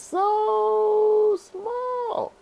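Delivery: very high, long-drawn squeaky "so small"; tiny squeal. Real, not acted. Teacher spotting the tiny arrow on screen, high drawn-out squeak. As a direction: stretch it out in a very high little voice.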